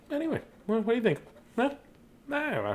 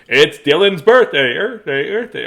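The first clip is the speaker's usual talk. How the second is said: ventriloquist style; 4 semitones higher, 6 dB louder than usual. Shown as a demonstration. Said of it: "Try to do it without moving your lips".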